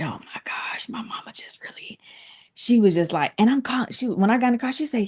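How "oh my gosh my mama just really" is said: hushed, breathless whisper. Retold or imitated. Daughter stunned after leaving the school, whispering. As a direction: whisper it in disbelief. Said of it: "I said".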